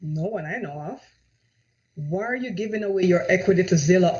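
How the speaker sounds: ordinary delivery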